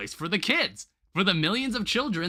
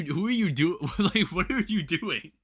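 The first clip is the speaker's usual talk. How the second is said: ordinary delivery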